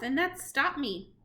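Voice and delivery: ordinary delivery, nothing marked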